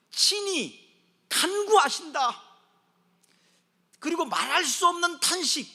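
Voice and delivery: loud, high, drawn-out proclamation; emphatic preaching. Acted. Preacher proclaiming the Spirit's intercession, slow and fervent. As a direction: slowly hammer out the key words.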